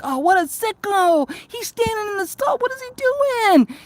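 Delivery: shocked disgust. Retold or imitated. Spotting a guy in the next stall, shocked and grossed out. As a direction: recoil at the man standing in the stall. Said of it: "and I'm like".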